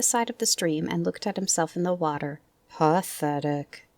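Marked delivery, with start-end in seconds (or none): 2.7-3.9 low, slow, dismal donkey voice; gloomy Eeyore. A narrator's reading. Old donkey looking at his reflection, gloomy. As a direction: drag it out glumly.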